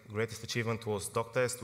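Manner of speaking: usual speaking voice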